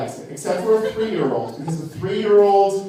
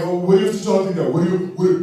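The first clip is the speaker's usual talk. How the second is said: mock straight guy; much faster than usual. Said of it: "straight guys are always like".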